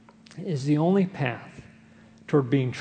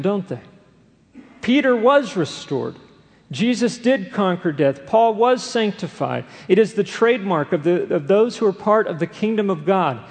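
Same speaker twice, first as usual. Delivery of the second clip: rising preaching; 3 semitones higher than usual. Acted. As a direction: lift the congregation toward hope. Sermon climbs to hopeful peak.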